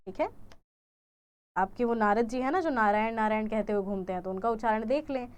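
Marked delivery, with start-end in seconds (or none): none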